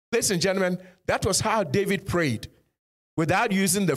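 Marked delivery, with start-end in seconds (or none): none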